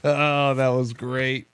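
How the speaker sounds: slow, spent sigh of amusement; real afterglow of a laughing fit; recovering from laughter. Real, not acted. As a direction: let it out slowly after cracking up.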